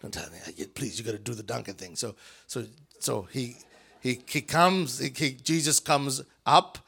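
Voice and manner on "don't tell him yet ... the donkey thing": hushed, soft conspiratorial whisper; whispered secret. Retold or imitated. Jesus whispering to his cousin John, hushed. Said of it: "John, Jesus says".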